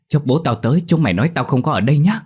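high, loud, fast call from hiding. A narrator's reading. Dậu, hiding behind the tree, calls out to friends quickly. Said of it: "nói vọng ra".

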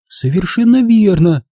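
high, earnest character voice; Angela answering seriously. A narrator's reading. Angela backing up the narrator, high serious voice. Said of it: "серьёзно ответила она".

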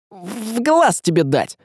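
high, slow, straining threat; strained through clenched teeth. A narrator's reading. Василий fights back from underneath, strained drawn-out threat. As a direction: push it out with effort while pinned. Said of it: "стиснув зубы".

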